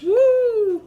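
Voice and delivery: high, drawn-out woo; excited whoop. Real, not acted. Showing off crawfish to eat, real excited whoop. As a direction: cheer happily for the food.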